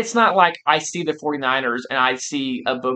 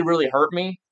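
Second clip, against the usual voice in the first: usual speaking voice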